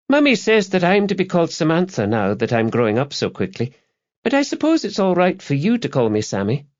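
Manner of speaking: put-on high, precocious little-girl voice; young daughter. A narrator's reading. Samantha tells her father her new name, high girlish voice. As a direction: explain it primly in a high child's voice.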